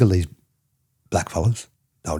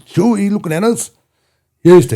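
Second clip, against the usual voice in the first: much higher and louder hammed-up challenge; mock cop challenge; 11 semitones higher, 6 dB louder than usual. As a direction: bark it like a bossy cop. Retold policeman confrontation.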